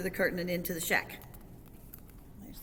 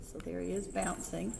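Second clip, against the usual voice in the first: ordinary delivery, nothing marked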